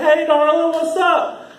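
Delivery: put-on high, loud holler at girls; hollered catcall. Character hollering at girls from the car, catcalling. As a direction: call out a cocky pickup line, high and loud. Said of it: "I was hollering at girls".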